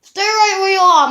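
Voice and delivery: high, loud rescuer call. Acted. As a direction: call urgently to someone lost. Rescuer calls to Helpy.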